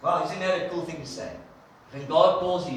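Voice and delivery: usual speaking voice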